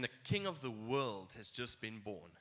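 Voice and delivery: normal talking voice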